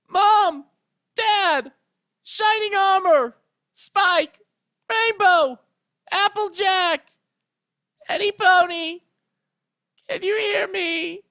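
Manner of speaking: very high, very loud calls across the sea, crying at the end; desperate yelling. Acted. Twilight calling her lost family and friends across the sea, yelling. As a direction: scream each name out over the water. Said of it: "yelled as loud as her voice could allow".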